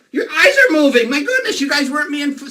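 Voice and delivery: high, loud cry of feigned shock; mock astonishment. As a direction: exclaim as if you can't believe it. Pretends shock that the drawing moves.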